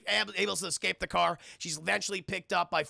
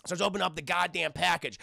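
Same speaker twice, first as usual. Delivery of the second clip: usual speaking voice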